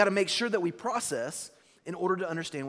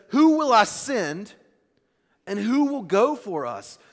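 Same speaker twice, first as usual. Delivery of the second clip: loud, slow opening, raised pitch; proclaimed question; 3 semitones higher, 7 dB louder, slower than usual. Acted. Preacher voices God asking in the heavenly throne, proclaiming. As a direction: put it out as a resounding call.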